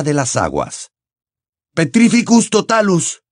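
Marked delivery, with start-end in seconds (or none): 1.7-3.2 shouted spell. Acted. Harry cursing the Inferius gripping him, shouting. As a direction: fire the curse at the Inferius. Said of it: "gritó Harry".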